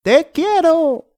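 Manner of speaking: very high, slow shouted declaration of love. Acted. Eduardo shouting his love up at Julia, high and slow. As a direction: belt it up high and slow to her. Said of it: "Eduardo gritó".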